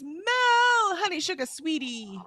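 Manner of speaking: high, swooping Southern endearment, intended as British; Southern accent. Acted. Reading Dee Dee's sweet greeting to Mel aloud, botched British turned Southern accent. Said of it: "it became a Southern accent".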